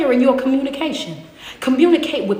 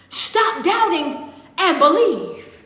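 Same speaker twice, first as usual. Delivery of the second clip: slow commanding Jesus; slower than usual. Acted. Jesus commanding Thomas, drawn out.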